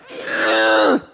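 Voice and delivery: a frustrated parent's groan; a pained, fed-up parent groan; exasperated groan. Retold or imitated. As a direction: groan in defeat at the kid's attitude.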